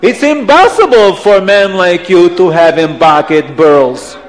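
put-on loud accented insistence, P turned to B; emphatic accent, falling away to the end; Abdallah's accent. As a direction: insist with "imbossible" and "burls". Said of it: "you have to say impossible".

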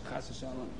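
hushed, breathy aside; whispered aside. A narrator's reading. Storyteller warding off harm, whispered.

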